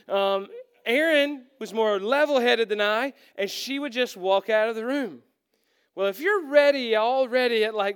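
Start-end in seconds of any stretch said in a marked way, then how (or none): none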